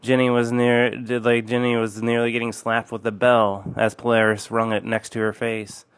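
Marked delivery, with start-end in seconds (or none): none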